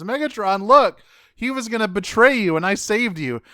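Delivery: high, loud eager boast; eager Starscream. Retold or imitated. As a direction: beg for credit. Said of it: "he goes".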